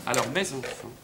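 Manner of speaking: low, soft, subdued address; deflated. Acted. Husband takes stock after failed order, deflated. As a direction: let the energy drop right out.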